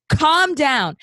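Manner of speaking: loud, high-pitched mock command; mocking order. Host parroting the librarian, loud mockery. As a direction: parrot a bossy order at full tilt.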